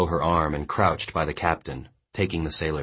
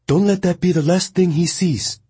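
high, louder woman's voice; Mongnan's urgent plea; 14 semitones higher, 6 dB louder than usual. A narrator's reading. Mongnan urgently pleading with the boy over the guards.

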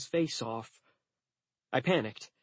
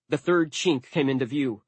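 usual speaking voice

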